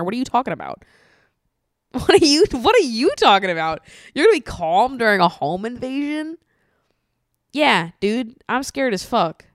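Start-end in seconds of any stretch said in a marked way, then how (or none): 1.9-6.4 louder, higher disbelieving outburst; incredulous outcry. Real, not acted. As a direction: call out the absurdity loudly. Incredulous rant at calm people.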